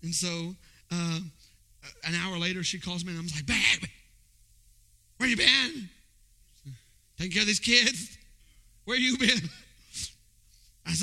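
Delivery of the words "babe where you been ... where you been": louder, higher, teasing where-you-been whine; mock exasperated husband. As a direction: play the impatient husband grilling his wife. Acting annoyed at wife on phone.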